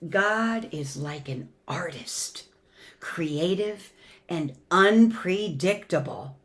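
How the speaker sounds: storybook reading with swelling and hushed lines; gentle expressive story voice for the kids; reading to children. Acted. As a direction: read it like a bedtime picture book. Reads picture book to congregation.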